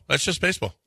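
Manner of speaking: a hint of Bish shrugging it off; mild imitation of a shrugging coach. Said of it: "That's my Bish imitation".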